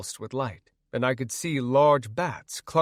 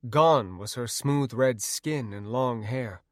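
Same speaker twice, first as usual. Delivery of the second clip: ordinary delivery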